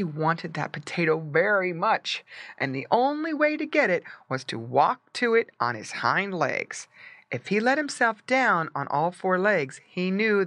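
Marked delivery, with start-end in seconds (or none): none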